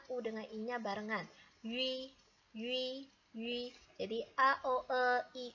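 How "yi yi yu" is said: "Yu" with lips rounded as for U while saying I; careful vowel demo of "Yu". Shown as a demonstration. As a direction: say U and I together on "Yi". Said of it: "Ini bacanya Yi".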